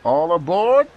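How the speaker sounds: booming All aboard; conductor's shout. A narrator's reading. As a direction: call everyone onto the train. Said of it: "the conductor shouted".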